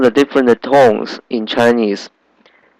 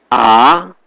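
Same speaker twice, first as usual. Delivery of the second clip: A sliding upward; second tone. Shown as a demonstration. Teacher reading the tone table, rising A. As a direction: lift A like a question. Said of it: "is going up".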